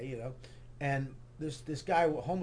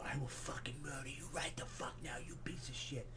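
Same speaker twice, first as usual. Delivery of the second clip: furious whisper, right in his face; acted menacing whisper; seething angry threat; 15 dB quieter than usual. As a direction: whisper the threat through rage. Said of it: "I'm so angry. I go".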